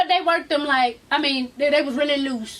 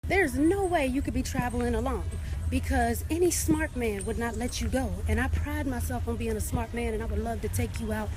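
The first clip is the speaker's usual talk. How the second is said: low, soft, suave sweet-talk; smooth-talker voice, dropped low and quiet; smooth suitor; 6 semitones lower, 8 dB quieter than usual. Retold or imitated. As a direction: imitate a man pouring on the charm. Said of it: "y'all should have heard him".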